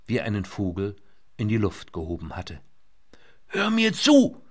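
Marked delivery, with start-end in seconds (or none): 3.5-4.4 high shout over the noise; over helicopter. A narrator's reading. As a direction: call out over roaring rotors. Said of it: "schrie Lynette gegen den Lärm der Rotoren an".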